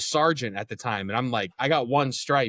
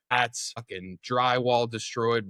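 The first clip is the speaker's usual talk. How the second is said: ordinary delivery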